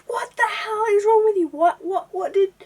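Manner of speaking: high, loud, furious demand; outraged yell. Retold or imitated. Jasper yelling at the photographer, high and loud. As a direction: shout at the photographer in disbelief. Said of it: "Jasper, like, says".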